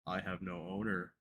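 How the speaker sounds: low, soft creature voice; low muffled Hypno. Acted. Hypno speaks.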